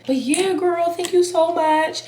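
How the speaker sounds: high, bright gushing thanks; excited thanks. Real, not acted. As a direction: gush with delight at the gift.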